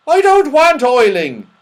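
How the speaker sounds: put-on high, loud indignant old man; saucepan man. Saucepan man mishearing boiling as oiling, loud protest. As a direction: protest loudly at a misheard word.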